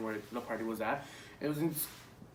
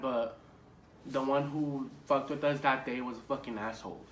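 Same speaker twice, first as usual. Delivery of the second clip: usual speaking voice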